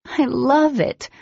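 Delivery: high, slow delighted gush; really mean it, delighted. A narrator's reading.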